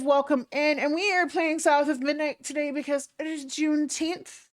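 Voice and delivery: high, peppy streamer voice; perky hostess welcoming viewers; cute anime streamer. Acted. Greeting raiders in stream voice.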